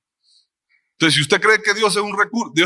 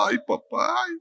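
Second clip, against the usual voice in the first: high, breathy shocked exclamation, softer; startled gasp; 12 semitones higher, 7 dB quieter than usual. Retold or imitated. Preacher reliving God's answer, startled and high. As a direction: jump at the unexpected answer. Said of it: "Se me quitaron las lágrimas ahí del susto".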